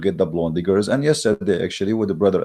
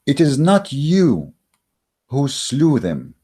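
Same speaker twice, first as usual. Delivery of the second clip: slow solemn reading; 4 semitones higher, slower than usual. Shown as a demonstration. Reading the verse aloud to viewers, slow and solemn. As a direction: intone the verse slowly like scripture. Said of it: "let us read together".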